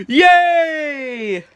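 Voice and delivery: loud, high, drawn-out yay; big high whoop of joy; cheer. Acted. Cheering a character's revival.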